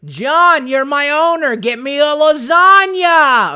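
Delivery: loud, high, slow cartoon cat wail; hammed-up whining cat calling for his owner; Garfield impression. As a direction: stretch every vowel and bellow it. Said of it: "guess Garfield there".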